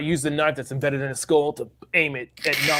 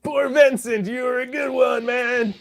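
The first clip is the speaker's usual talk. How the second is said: mournful shout; 9 semitones higher, 4 dB louder, slower than usual. Acted. Mourning a lost crewmate.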